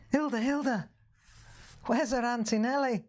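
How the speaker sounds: high-pitched Liverpool imitation; Scouse friend. Storyteller repeating the confused friend's question, high Scouse. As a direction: replay the punchline in a high Scouse voice. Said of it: "Scouse, Liver-, Liverpool".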